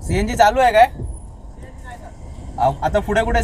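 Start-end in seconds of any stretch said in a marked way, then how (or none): 0.0-0.9 loud high call across the pump. Real, not acted. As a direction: shout the question to the attendant. Calling out to pump attendant.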